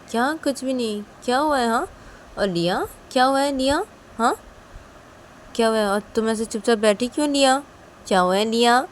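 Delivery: pressing, concerned interrogation; insistent questioning. Acted. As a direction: demand to know what happened. Father questions both girls insistently.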